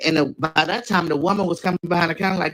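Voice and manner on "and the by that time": ordinary delivery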